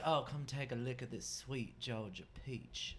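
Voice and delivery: low, soft, put-on seduction; mock boudoir voice. Joking to friends about peach sexting, mock boudoir voice. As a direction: play a hushed, sultry Southern flirt. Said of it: "my normal bourgeois voice".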